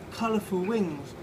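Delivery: normal talking voice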